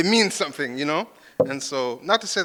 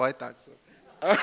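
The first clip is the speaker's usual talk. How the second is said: laughing; 7 semitones higher, much slower than usual. Real, not acted. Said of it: "repeats it, laughing".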